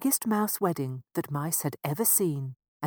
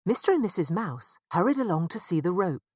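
usual speaking voice

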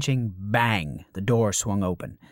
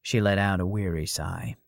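usual speaking voice

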